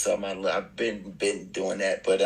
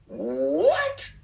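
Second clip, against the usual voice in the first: high disbelieving what; stunned what; 13 semitones higher than usual. Retold or imitated. Hearing Bruno wants to work with him, stunned. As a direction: squeak it out in shock.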